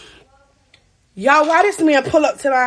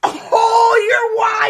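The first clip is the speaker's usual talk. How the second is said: shocked oh; 9 semitones higher, 3 dB louder than usual. Retold or imitated. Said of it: "I'm like".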